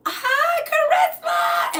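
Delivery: very high, drawn-out holler; shouted greeting. Real, not acted. Streamer hails a viewer joining the stream, shouting high and excited. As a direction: sing out the name high and loud.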